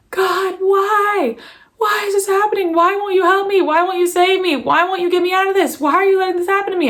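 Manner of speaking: high-pitched desperate wail; retold crying yell. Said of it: "I was, like, yelling".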